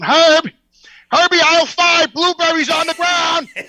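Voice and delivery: loud store intercom page, drawn out; intercom call. Retold or imitated. Store clerk paging Herb over the loudspeaker, drawn out.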